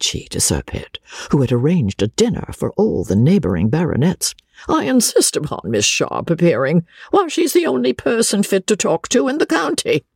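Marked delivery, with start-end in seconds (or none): 4.7-10.0 put-on high, indignant old lady's voice; a rich old spinster scoffing. Old lady to Sir Pitt, indignant and high.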